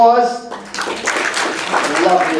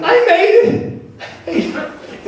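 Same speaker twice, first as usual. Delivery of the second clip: triumph; slower than usual. Pretend. Triumphant crawl to doorway.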